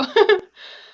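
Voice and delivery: loud, high laughing squeal; high teary laugh burst. Real, not acted. Viewer breaks into a loud, tearful laugh. Emotional laugh during viewing.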